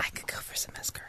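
soft quick whisper. Real, not acted. Whispered aside.

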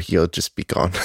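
normal talking voice

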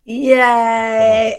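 loud, drawn-out yay; delighted cheer. Real, not acted. Host applauding a guest's joke, delighted cheer.

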